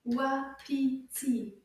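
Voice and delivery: "Wapiti" drawn out in three slow separate beats; syllable by syllable. Shown as a demonstration. Teacher repeats "Wapiti" for children to clap along, slow and split up. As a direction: break "Wapiti" slowly into its syllables. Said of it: "Let's try that again".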